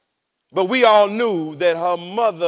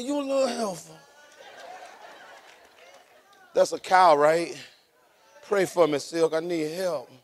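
much softer, half to himself; hushed aside; 5 semitones lower, 7 dB quieter than usual. Real, not acted. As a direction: drop the voice for a private plea.

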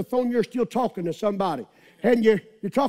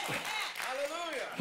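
much softer, slow, hushed; breathless hallelujah; 7 semitones higher, 16 dB quieter, slower than usual. Real, not acted. Preacher after the climax, hushed praise. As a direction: breathe it out spent.

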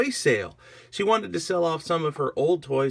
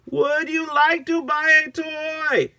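slow, loud, very high, stretched words; over-enunciated repeat; 6 dB louder, slower than usual. Acted. Agnes to an uncomprehending minion, slow and loud. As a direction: spell it out for someone who doesn't understand. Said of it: "I don't know what he's saying".

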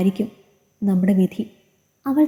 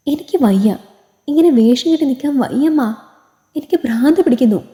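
higher-pitched desperate outburst; anguished cry out; 5 semitones higher than usual. A narrator's reading. Bride crying out to her mother that she can't go on, desperate. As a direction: cry out at the end of endurance. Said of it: "അവൾ ഉറക്കെ വിളിച്ചു കൂവി".

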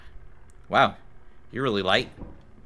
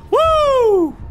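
long, high, loud "woo"; high-pitched cheer; victory whoop; 5 dB louder than usual. Real, not acted. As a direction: whoop in celebration. Cheers getting the creature.

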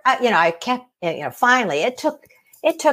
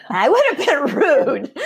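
laughing; 6 semitones higher than usual. Real, not acted. Laughs mid-sentence.

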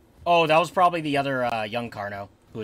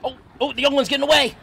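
excited shout; 7 semitones higher than usual. Real, not acted. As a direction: holler after the fleeing one. Chasing the fleeing young one.

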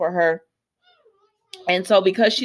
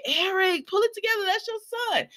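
high, soft mock reproach; mock scolding; 12 semitones higher, 6 dB quieter than usual. Host telling a character to behave for his son, mock scolding.

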